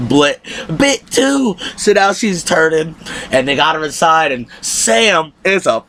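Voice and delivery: ordinary delivery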